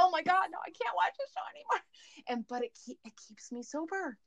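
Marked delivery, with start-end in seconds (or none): 0.0-1.8 high, fast, horrified wail; dismayed outburst. Retold or imitated. Watching a relapse on Intervention, shrieks in high dismay. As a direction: squeal it out like the ending just crushed you. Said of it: "and I'm like".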